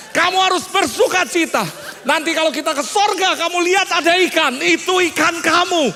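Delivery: put-on high, loud rallying of a child; acted over-enthusiastic reassurance; mock triumphant encouragement. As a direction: proclaim joyful news to cheer up a child. Hypes up consolation for crying child.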